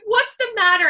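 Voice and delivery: imitated motherly alarm; alarmed mom. Mom seeing her daughter run out crying, alarmed.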